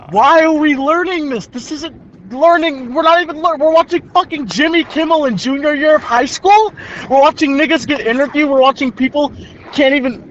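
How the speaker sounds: high-pitched, incredulous venting; exasperated rant. Real, not acted. Junior venting about watching late-night clips in school, exasperated. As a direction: pile up the complaints in high-pitched disbelief.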